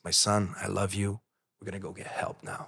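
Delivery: soft, tender mother's voice. Retold or imitated. Mother greeting her son at the door, tender. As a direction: say it gently, like a mother forgiving. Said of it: "She says".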